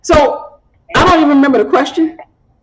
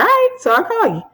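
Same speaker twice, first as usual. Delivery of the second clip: bright brush-off; 10 semitones higher, 3 dB quieter than usual. Retold or imitated. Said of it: "I was like".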